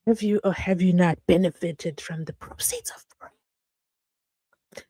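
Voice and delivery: slow hushed accusatory whisper; mock interrogation whisper. Streamer teasing viewer, mock prosecutor whisper.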